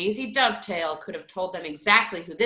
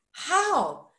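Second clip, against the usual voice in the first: high startled question; taken aback; 8 semitones higher than usual. A narrator's reading. Bert asking how Major Roach died, taken aback. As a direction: ask it in sudden shock. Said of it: "taken aback".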